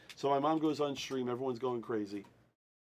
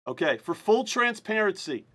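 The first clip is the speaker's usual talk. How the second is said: raised, measured announcing voice; formal announcer; 6 semitones higher, 6 dB louder, slower than usual. Real, not acted. Host opening the draw rules for viewers, announcer voice. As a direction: open an official announcement.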